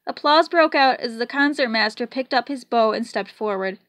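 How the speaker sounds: ordinary delivery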